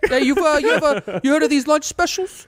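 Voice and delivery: high, hammed-up East Coast pitchman; East Coast accent. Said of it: "we're terrible at this accent".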